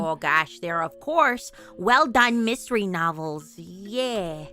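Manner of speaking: low, loud mother's reading voice; put-on low character voice; game mother.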